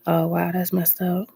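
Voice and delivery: normal talking voice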